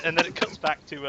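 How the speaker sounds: ordinary talk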